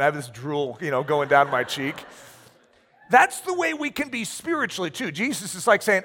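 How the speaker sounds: normal talking voice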